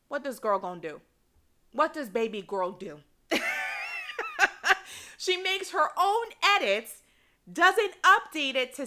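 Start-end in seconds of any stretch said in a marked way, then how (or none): 3.3-5.1 shrieking laugh, way up high; delighted screech of laughter; high squealing laugh. Real, not acted. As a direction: burst into a high-pitched cackle. Laughing at own story.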